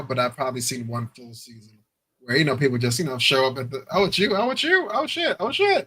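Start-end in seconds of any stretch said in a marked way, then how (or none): 3.9-5.9 rising, climbing high mock shock at the first sight; mock surprise. Newlywed meeting spouse at the altar, mock shocked. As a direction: play the stunned spouse seeing their match.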